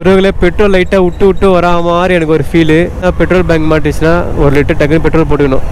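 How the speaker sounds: ordinary talk, nothing marked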